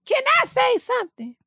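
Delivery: high, put-on voice of Mama Joyce; imitating Mama Joyce. Imitating Mama Joyce to her cohost, high put-on voice. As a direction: pipe up like Mama Joyce asking to speak. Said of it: "Tiny sound like Mama Joyce".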